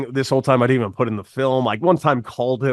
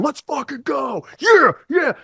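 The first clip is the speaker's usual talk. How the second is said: high, slow yell of triumph; the team storming into the dugout, shouting in high triumph; celebrating shout; 13 semitones higher, slower than usual. Retold or imitated. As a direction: yell it like the team after the strikeout. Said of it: "Jeff doesn't hear Jorge going".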